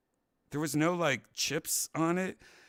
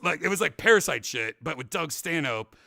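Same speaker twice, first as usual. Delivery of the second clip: ordinary delivery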